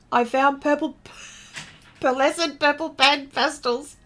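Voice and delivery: laughing through the tongue twister; reading it aloud for viewers, laughing; tongue twister attempt. Shown as a demonstration. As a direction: stumble over the words, giggling. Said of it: "Now say that out loud".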